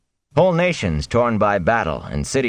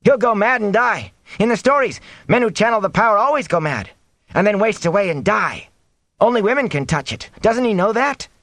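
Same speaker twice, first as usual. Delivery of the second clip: high-pitched, agitated outburst; bursting out. A narrator's reading. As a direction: blurt it out in alarm. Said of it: "Ewan Fingar burst out".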